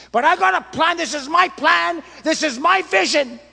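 high, loud insistence on my plan; possessive shout. Acted. Preacher mocking a pastor who owns his vision, loud insistence. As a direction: insist loudly it is your own vision.

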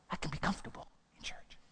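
whispered mock churchgoer. Preacher whispers the churchgoer's excuse to the congregation. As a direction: breathe it out as a mocking whisper.

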